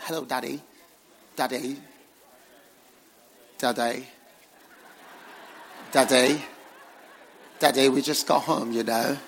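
loud mock-British child calling Daddy; British accent. Children calling to their pastor father, mock British accent. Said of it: "speaking to me nice British accent".

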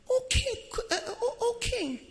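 sweet and soft, high and tender; soft gentle voice. Shown as a demonstration. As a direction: coax him gently like a loving wife. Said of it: "your soft voice is necessary".

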